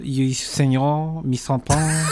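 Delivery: mock-French gibberish in a pretentious accent; hammed-up, clumsy French accent; bad French accent. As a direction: fake being a pretentious French person. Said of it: "sound like Quentin Tarantino doing a French accent".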